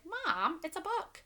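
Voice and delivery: put-on a young child telling mom it's only a book. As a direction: whine it like a puzzled little kid. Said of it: "And I would be like".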